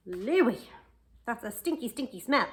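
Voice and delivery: disgusted telling-off. Pretend. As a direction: scold the stinky dog. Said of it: "or would we go".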